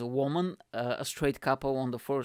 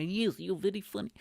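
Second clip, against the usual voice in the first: higher-pitched mock Eastern European accent; 6 semitones higher than usual. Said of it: "when I make fun of Eastern Europeans".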